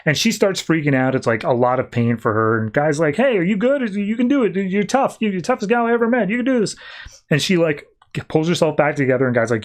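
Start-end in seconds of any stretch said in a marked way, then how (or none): 3.2-6.8 high-pitched, quick pep talk; urgent encouragement. Retold or imitated. Guy cheers on Bleez as she freaks out, urgent high encouragement. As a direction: cheer them on in a raised voice.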